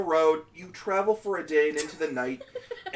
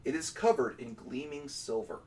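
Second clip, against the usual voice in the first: soft, measured scene setting; story narration; 6 semitones lower, 10 dB quieter than usual. Acted. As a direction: paint the vision quietly. GM narrates the vision.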